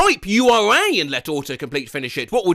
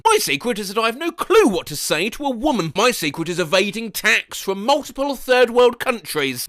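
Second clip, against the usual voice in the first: ordinary delivery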